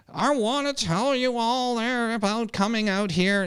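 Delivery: put-on aunt character, very high-pitched; Sarah Jones as her aunt. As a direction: play an aunt in a high, exaggerated accent. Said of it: "like a Sarah Jones when she puts on her aunt".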